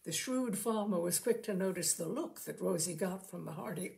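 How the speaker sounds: plain reading voice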